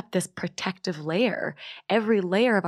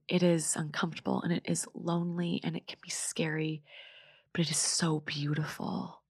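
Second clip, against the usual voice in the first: soft and calm; 6 dB quieter than usual. Real, not acted. Closing reflection, fading.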